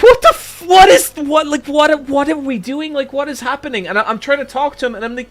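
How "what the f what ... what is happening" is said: retold panic. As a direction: sputter in disbelief, then trail off. Panicked self-quote in strange neighborhood.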